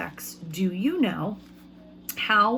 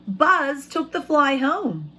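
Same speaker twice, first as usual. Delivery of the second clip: slow, louder, higher read; storybook reading; 4 semitones higher, 4 dB louder, slower than usual. A narrator's reading. Teacher reading to her class, dramatic slow narration. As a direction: stretch out the fly's anger for the kids.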